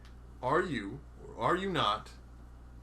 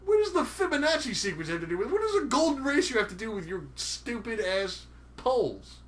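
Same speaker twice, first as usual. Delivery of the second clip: indignant; 8 semitones higher, 4 dB louder than usual. Real, not acted. Indignant shouting question.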